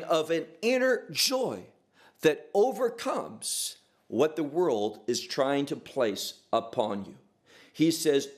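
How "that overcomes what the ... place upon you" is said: usual speaking voice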